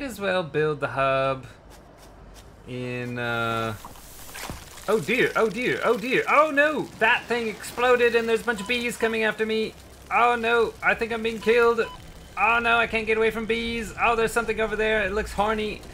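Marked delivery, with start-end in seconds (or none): 4.9-13.9 high-pitched, alarmed game commentary; flustered panic. Real, not acted. Player chased by exploding swarm of bees, flustered panic. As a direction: fret aloud while under attack.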